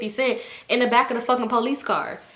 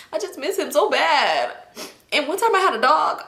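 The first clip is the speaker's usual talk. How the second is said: put-on drunken crying; 6 semitones higher than usual. Drunk friend grieving uncle and dog, imitated weepy whine. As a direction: whine it like a mock drunk sob. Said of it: "But she was drunk".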